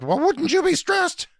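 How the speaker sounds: high, strained, frantic outburst; snapping. Retold or imitated. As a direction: squeal it out in panic at the nurse. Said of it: "And I snapped".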